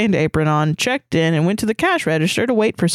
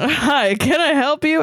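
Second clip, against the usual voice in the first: higher-pitched, cheery greeting; 6 semitones higher than usual. A narrator's reading. Bakery worker greets a customer in a cheery voice. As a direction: read it as a chirpy, helpful hello. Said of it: "I said in a cheery voice".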